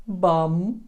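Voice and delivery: ordinary talk, nothing marked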